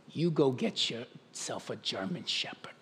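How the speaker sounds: a hint of a New York accent, low-key; lightly done New York accent. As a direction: quote Paul with a slight New York accent. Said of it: "I trust that accent".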